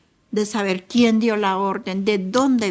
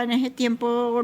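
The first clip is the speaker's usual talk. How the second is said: usual speaking voice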